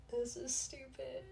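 whispered inner thought. Retold or imitated. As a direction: whisper it to yourself. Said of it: "I'm just like".

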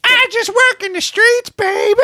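very high, slow put-on woman's voice; countryside chick. Host playing the banshee as a streetwalker, high countryside chick. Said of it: "sound like a countryside chick".

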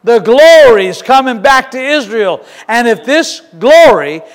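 loud, slow building sermon cry; preaching at full force. Acted. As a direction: preach it out to the hall.

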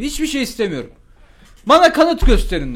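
high, loud staged outrage; fake anger. Student to principal, theatrically angry. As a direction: play up the indignation. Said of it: "fake sinirleniş".

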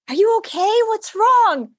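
high, gentle asking after her; concerned. Retold or imitated. Teacher asking crying student what's wrong, concerned.

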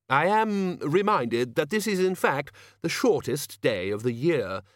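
a measured, slightly pompous concession; lightly voiced Ridcully.

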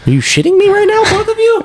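can't believe they don't know it; incredulous. Real, not acted. Host grilling co-hosts on the character's name, incredulous.

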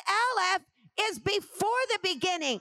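building preacher's emphasis. Shown as a demonstration. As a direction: hammer it home again, rising. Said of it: "Maybe you didn't hear me".

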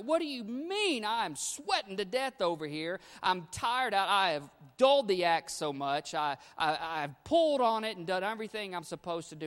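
loud, high, frustrated protest; exasperated boy. Retold or imitated. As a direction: yell it out, worn out and fed up. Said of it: "He's like".

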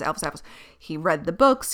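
ordinary talk, nothing marked

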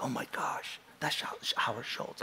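hushed, unvoiced whisper of recognition; stammering, awestruck whisper; starstruck whisper. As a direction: whisper it so the celebrity beside you won't hear. Starstruck beside a famous diner.

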